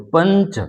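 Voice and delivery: पंच with the nasal coming after प; anusvara demo. Shown as a demonstration. Teacher repeating पंच to class. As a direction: say पंच letting the nasal follow the vowel. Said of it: "अर्थात प के बाद".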